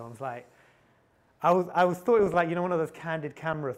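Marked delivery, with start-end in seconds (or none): 1.4-3.7 much higher and louder, words tumbling in disbelief; real astonished exclamation; incredulous outburst. Real, not acted. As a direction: burst out as if it can't be real. Disbelief at pitch idea.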